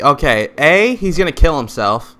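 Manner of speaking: ordinary delivery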